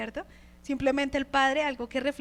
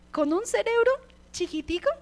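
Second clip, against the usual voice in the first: emphatic tiny; 8 semitones higher, 6 dB louder than usual. Acted. Mocking tiny ant brain.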